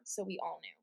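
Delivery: ordinary talk, nothing marked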